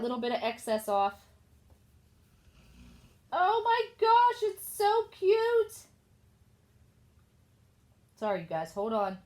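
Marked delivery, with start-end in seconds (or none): 3.3-5.9 high, slow, squealing delight; drawn-out high gush of delight. Real, not acted. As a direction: coo over it like a new puppy. Gushing over finished craft.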